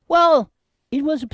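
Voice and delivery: mock high voice. Mocking high voice.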